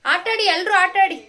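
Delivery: loud, high call to a group. Real, not acted. Mother calls out to all children.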